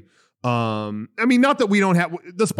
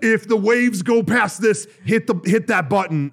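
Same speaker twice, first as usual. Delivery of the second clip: quoting an actor; 7 semitones higher, 3 dB louder than usual. Retold or imitated. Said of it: "funniest outtakes".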